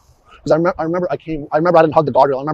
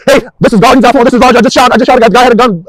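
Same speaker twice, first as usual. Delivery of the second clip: loud, high, breathless report; officer reenacting his emergency call, loud and urgent; 9 semitones higher, 12 dB louder than usual. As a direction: shout the report into the phone mid-chase. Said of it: "I want the volume of your voice to be the same".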